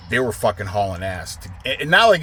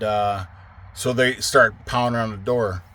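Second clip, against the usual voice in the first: ordinary delivery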